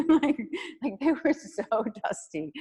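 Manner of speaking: amused, breaking into laughter mid-phrase; laughing. Real, not acted. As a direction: laugh as you admit it. Laughing at own dusty photos.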